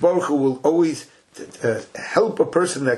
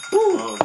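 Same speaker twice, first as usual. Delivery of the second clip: a sudden, much higher whoop. Real, not acted. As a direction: let out a quick cheer of delight. Cheer ending the first explanation.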